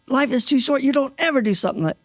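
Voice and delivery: put-on urgent, upset lecture to a young man; retold angry scolding. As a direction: scold him, re-enacting your anger. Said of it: "I got angry with him".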